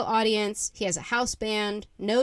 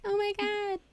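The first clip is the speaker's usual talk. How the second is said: high-pitched, softened little squeal; starstruck awe; 13 semitones higher, 4 dB quieter than usual. Retold or imitated. Reacting to a show watched as a kid, high starstruck squeal. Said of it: "I'm like".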